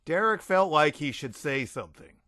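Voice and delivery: usual speaking voice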